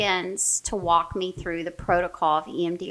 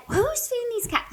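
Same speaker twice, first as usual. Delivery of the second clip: retold intense outburst; 4 dB quieter than usual. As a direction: demand it in an acted, high-strung squeal. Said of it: "just so intense, just shaking".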